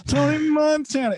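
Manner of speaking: high, stretched-out hook imitation; mimicked rapper holler, long held vowels. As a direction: keep chanting it high, oblivious to everything. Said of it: "and he's still".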